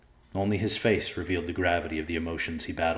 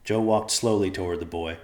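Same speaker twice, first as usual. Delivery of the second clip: plain reading voice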